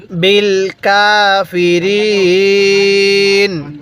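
"কাফিরিন" with the last vowel held very long; elongated recitation. Shown as a demonstration. Teacher showing how to read, long drawn-out recitation. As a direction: stretch কাফিরিন slowly before closing on n. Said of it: "কিভাবে পড়ব".

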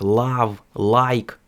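love and like with a hard Ukrainian l; Ukrainian-accented love, like; Ukrainian manner. Shown as a demonstration. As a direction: say love, like with heavy l and w-like v. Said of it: "на український манір можна би було вимовити як".

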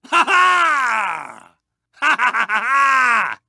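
big staccato laugh ending long; triumphant laugh. Acted. Racer delighted with a power-up, grand gloating laugh. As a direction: laugh grandly, stretching the last note.

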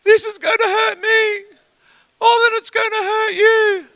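hammed-up weepy father about to punish; imitating crying dad. Son imitating his crying dad holding the stick, mock tearful. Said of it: "he starts crying".

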